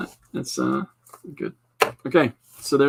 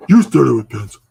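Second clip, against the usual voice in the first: much louder, mock-stern repeat; loud scolding; 11 dB louder than usual. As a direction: bark the reminder at yourself.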